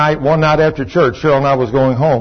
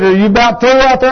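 a hint of a wife's higher voice; wife calling out; 7 semitones higher than usual. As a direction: imitate checking on a husband outside. Retold wife calling out the door.

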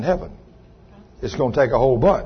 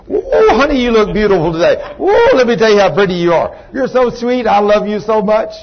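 mock sweet-talking husband, high and laughing; husband sweet-talking his upset wife; 4 semitones higher, 3 dB louder than usual. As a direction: coo at her with a laugh. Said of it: "look at her and say".

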